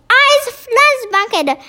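high-pitched mock roar; giant's roar. A narrator's reading. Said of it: "roared the flagellum".

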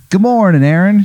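high, bright morning greeting; dad's cheery greeting. Acted. Dad waking his son with a high cheerful hello. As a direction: greet him sunnily from the doorway. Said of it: "He goes".

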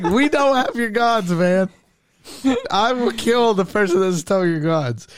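lightly voiced bold denial; defiant Jacob. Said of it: "He's like, he's like".

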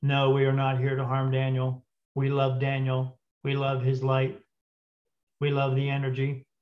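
monotone talking-machine imitation; computer voice. Spirit box answers whether it will harm Daniel, computer voice. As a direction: speak evenly on one note like a computer. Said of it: "It said in that computer voice".

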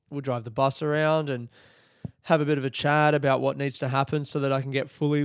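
normal talking voice

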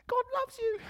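very high, strained mock preacher; young preacher's feeble imitation. Young guy preaching after the old biker, high mock voice. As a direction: squeak it out without any thunder. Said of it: "just doesn't pack the punch when you speak".

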